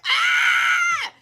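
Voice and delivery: high squealing "ah"; mock scream. Contestant squealing in a bug box. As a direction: let out a comic shriek.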